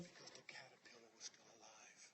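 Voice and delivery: hushed whisper, low and soft; whispered reveal. Acted. Speaker reveals the twist to the crowd in a whisper. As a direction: drop to a secret whisper.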